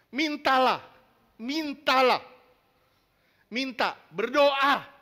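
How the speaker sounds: loud, slow scripture urging; forceful preaching. Acted. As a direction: press the verse on the crowd.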